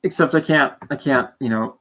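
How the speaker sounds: ordinary delivery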